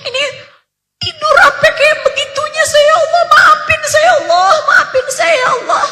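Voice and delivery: high, tearful plea for forgiveness, voice breaking; woman weeping as she begs Allah's forgiveness; sobbing prayer. Retold or imitated. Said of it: "Beliau nangis terisak-isak".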